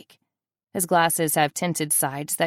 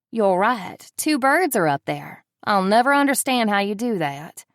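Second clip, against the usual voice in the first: a light, put-on Southern accent, a bit louder; 4 semitones higher, 4 dB louder than usual. Playing along with Jake about hidden cameras in a mock Southern accent. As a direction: keep the joking Southern accent going. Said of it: "keeping with the southern accent".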